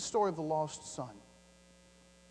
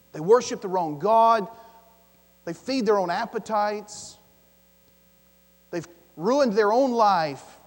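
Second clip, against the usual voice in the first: loud, slow indignant critic; mock the grumbling crowd; indignant complaint; 4 semitones higher, 12 dB louder, slower than usual. Critics scolding Jesus, loud indignant. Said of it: "a bunch of people saying".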